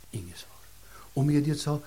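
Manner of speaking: ordinary delivery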